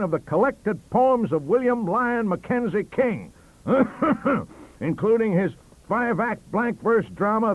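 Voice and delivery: loud, high, gleeful boast; boasting loudly. Acted. Stacey boasting to the table, loud. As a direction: warn them off, grinning. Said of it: "shouted Professor Stacey".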